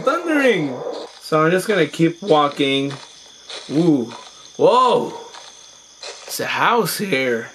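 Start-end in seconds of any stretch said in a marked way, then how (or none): none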